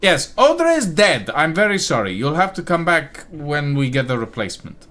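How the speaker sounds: high, loud, flustered official voice; comic official. Acted. Official scrambles to explain the death to the party, high and loud. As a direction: play a frantic, embarrassed clerk. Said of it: "one-man sketch show".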